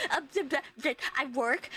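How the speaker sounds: mock tongue-tied stutter; stammering. Streamer imitating her answer about college, stammering. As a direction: stick on the first sound before getting it out. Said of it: "I stammer my way".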